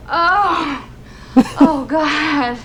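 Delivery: high moans tailing off after pretended ecstasy; faked climax. Shown as a demonstration. Woman finishing her demonstration for a friend, in high faked moans. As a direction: let the faked cries wind down, still high. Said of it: "that they fake orgasm".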